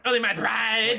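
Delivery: high loud mock line; mimicking character line. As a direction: copy her proud delivery. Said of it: "Why does she say it like that?".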